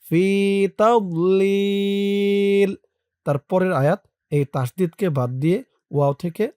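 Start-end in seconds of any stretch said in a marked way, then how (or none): 0.1-2.7 তাদলিল held very long on the stop; stopping with long madd. Shown as a demonstration. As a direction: stretch তাদলিল slowly at the pause. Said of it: "এভাবে দিয়েছি". (থামার সময় তাদলিল লম্বা টেনে)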